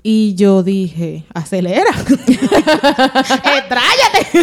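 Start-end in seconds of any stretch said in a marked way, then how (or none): 1.3-4.4 repeating the dark thought through laughter, rising high; half-laughing quote, pitch climbing. Retold or imitated. Recalling the voice telling her to crash, laughing high.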